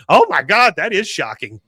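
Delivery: high, loud mock gasp of shock, easing down; Peggy. Wife heard from the other room, mock shocked outburst. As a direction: imitate a scandalized wife calling out. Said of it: "you just hear Peggy go".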